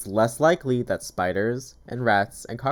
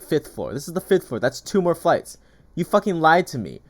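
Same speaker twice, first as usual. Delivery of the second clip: loud, higher furious tenant yelling; retold angry yell; 6 semitones higher, 5 dB louder than usual. Angry tenant yelling at the broker's rep, retold loudly.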